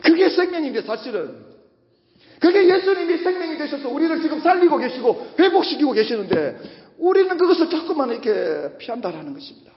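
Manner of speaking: usual speaking voice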